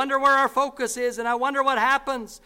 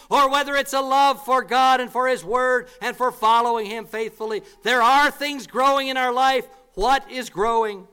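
loud, pressing sermon climax; louder preacher's call, building then asking; preaching at full force; 7 dB louder than usual. Acted. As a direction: drive the question into the room.

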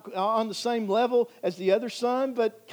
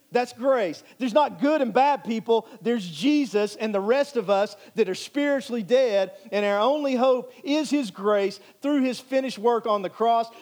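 raised, emphatic preaching voice; building sermon emphasis; 3 semitones higher, 3 dB louder than usual. Acted. Pastor pressing the point of grace to the congregation, emphatic preaching. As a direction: preach it with conviction, a bit louder.

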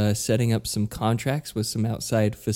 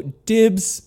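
eager dibs; 10 semitones higher, 5 dB louder than usual. Retold or imitated. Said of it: "I could just go".

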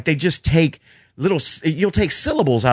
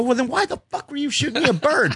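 incredulous retort; 13 semitones higher than usual. Retold or imitated. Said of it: "I go".

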